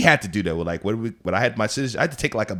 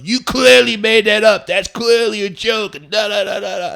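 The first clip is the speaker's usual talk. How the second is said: mock online commenters; 11 semitones higher, 7 dB louder than usual. Commenters doubting his TikTok story, loud mocking imitation. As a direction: hammer it out like a scoffing commenter. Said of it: "in the comments going".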